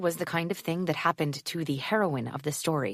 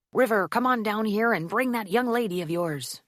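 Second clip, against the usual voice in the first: put-on old man calling; 5 semitones higher than usual. A narrator's reading. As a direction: call down the hall like an old man. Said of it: "a voice called out".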